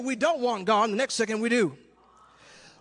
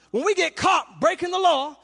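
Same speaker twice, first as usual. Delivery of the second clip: normal talking voice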